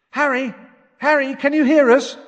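high, loud call through the boards. A narrator's reading. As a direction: yell to be heard through a wall. Shouting through blocked fireplace.

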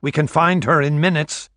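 loud call across the deck. A narrator's reading. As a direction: call it out firmly. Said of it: "Esterhazy called out".

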